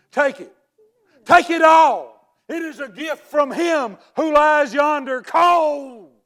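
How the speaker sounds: high, booming theatrical proclamation; grand declamation. Acted. Uncle hands nieces the dead man's money, grandly declaiming. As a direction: shout the gift out like a stage tragedian.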